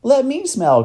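put-on high, loud child's plea; eager cry. Girl begging for a turn to smell, eager cry. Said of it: "cried Lindy".

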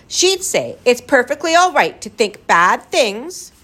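put-on high, emphatic child's scolding. A narrator's reading. Lindy quoting her mommy to the gaboon, scolding. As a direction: scold as a prim little girl. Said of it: "Lindy scolded".

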